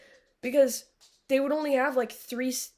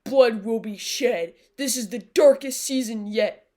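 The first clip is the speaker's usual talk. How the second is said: mock trailer voice; 7 dB louder than usual. Mocking the show's promos with a louder dramatic read. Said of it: "They were like".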